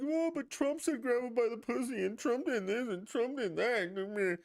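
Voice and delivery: high-pitched mocking imitation of complainers; whining objectors. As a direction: mimic people protesting in a silly high voice. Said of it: "And people are like".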